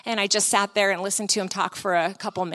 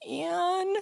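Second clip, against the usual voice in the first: soft, high, tentative call; gentle coaxing; 7 semitones higher, 7 dB quieter than usual. Retold or imitated. Speaker gently calls to the weeping woman, gentle coaxing. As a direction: say the name softly and high.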